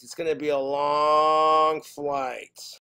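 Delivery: slow line with a hugely elongated "long"; dreading, drawn-out LONG. Acted. Dreading the flight in acted thought.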